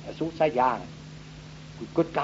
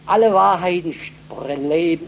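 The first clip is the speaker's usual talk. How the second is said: loud, slowed, rising proclamation; emphatic preaching; 5 semitones higher, 9 dB louder, slower than usual. Real, not acted. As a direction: drive the words out from the pulpit. Preacher builds to a climax. (laut und langsam gepredigt)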